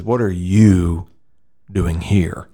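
slow question, "you" drawn out, then a pause; stress on YOU. Retold or imitated. God questioning Elijah after his prayer, slow stress on "you". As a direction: stretch "you" and hold before finishing. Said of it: "I believe the emphasis is".

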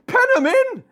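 high, urgent football-fan yell; shouting. Acted. Martin yells at kids dressed as sheep, high shout. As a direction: holler it from the stands. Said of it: "Martin shouts".

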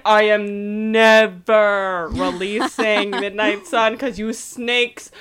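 hammed-up author's tantrum ending in a shout; Stephenie Meyer rant. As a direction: start firm and slow, then blow up into a yell. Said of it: "Stephenie Meyer was like".

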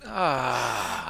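normal talking voice

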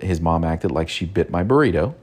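ordinary delivery

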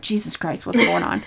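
mock alarm, kept low and quiet; muted exclamation. Retelling the knife scene to a co-host, muted mock alarm.

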